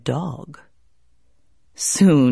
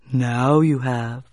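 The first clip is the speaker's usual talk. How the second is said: slow low turtle voice; 6 semitones lower, slower than usual. Acted. Turtle replies.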